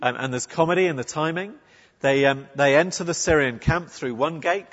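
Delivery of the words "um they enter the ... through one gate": normal talking voice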